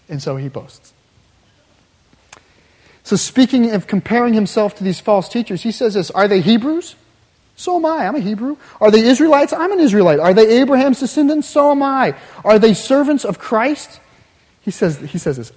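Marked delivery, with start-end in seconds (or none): 6.1-14.0 louder, climbing, rhetorical challenge; preacher voicing a proud apostle, louder; fiery boasting. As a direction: throw each question out like a dare. Paul's boast, quoted with rising fire.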